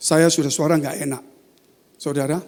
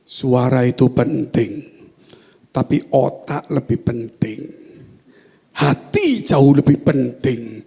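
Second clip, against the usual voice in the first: slow, low, weighty pronouncement; deep, drawn-out divine declaration; God's voice; 4 semitones lower, 5 dB louder, slower than usual. Retold or imitated. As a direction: speak as God, deliberate and forceful. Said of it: "Saya mendengar suara".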